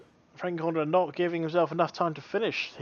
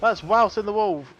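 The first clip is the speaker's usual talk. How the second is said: loud, higher excited race call; excited commentator; 4 semitones higher, 7 dB louder than usual. Real, not acted. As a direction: shout over the crashes. Live race play-by-play.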